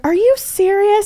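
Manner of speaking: very high, slow mock question; drawn-out, high imitation of disbelief; mimicked disbelief. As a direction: squeak it as the incredulous friend. Mimics friend's reply in quarrel.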